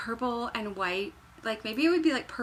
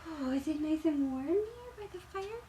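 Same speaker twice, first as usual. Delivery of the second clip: mock cosy host; 6 semitones higher, 3 dB quieter than usual.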